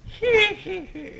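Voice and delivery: very high mock snicker; high fake "hee hee" laugh; villain laugh. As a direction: put on a squeaky villain titter. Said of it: "he's laughing again".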